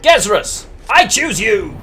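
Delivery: high, punchy summoning cry; mock battle call. Player summoning Gezrus to the turn, high mock-heroic call. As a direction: call it out high like a champion picking a fighter. Calling a card into play.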